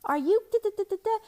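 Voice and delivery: high indignant mom. Retold or imitated. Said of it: "And I was like".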